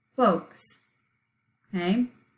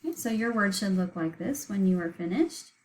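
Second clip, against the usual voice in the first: ordinary delivery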